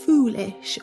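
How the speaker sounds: plain reading voice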